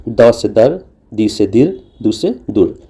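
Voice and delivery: द से दर spoken very slowly; slow demo. Shown as a demonstration. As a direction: spell out द से दर slowly for students. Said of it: "तो ये तो हो गया अ". (धीरे-धीरे समझाते हुए)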